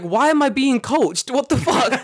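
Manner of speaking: high, loud exasperated protest; indignant inner rant. Retold or imitated. Runner rants at being coached, high and loud. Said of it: "and I'm like".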